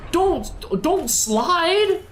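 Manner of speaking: high, slow pleading don't; begging the character not to slide; alarmed drawn-out cry. Real, not acted. Streamer pleading with the character not to slide, drawn-out high cry. Character sliding toward danger.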